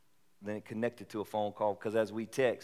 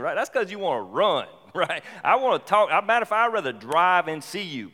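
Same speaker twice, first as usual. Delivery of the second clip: loud, high preacher exhortation; fired-up preaching; 8 semitones higher, 8 dB louder than usual. Acted. Preacher urging congregation, loud and high. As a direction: pound the point home, much louder.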